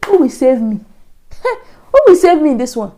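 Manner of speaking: retold dramatic appeal; pleading. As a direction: act out helpless pleading. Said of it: "I was pleading".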